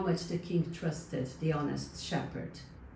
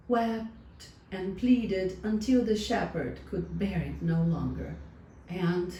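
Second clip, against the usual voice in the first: plain reading voice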